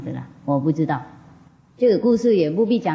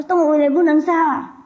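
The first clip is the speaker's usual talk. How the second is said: 动物也不能杀 repeated much higher and louder in disbelief; shocked echo; 8 semitones higher, 3 dB louder than usual. Retold or imitated. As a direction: echo the rule back in high-pitched shock. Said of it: "那个师父说动物也不能杀啊". (惊讶地尖声重复“动物也不能杀啊”)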